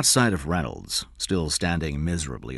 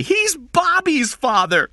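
put-on slow, very high, loud child's voice; desperate; 8 dB louder, slower than usual. A narrator's reading. Jeff corrects the kidnapper about the father, desperate. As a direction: plead the truth in a desperate high pitch. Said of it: "Jeff said desperately".

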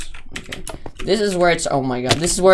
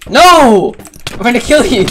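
playful yell; 9 semitones higher, 15 dB louder than usual. Real, not acted. Playful threat during game race.

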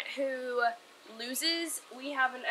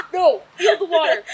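laughing while cold and wet; 3 semitones higher, 11 dB louder than usual. Real, not acted. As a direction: talk through laughter. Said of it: "I'm serious, it's so cold".

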